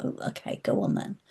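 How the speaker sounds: breathy whisper, quietly giving in; whispered agreement. Retold or imitated. Agreeing to the friend in the dream, whispered. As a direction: say it barely voiced. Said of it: "it was like".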